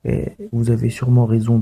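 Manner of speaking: ordinary talk, nothing marked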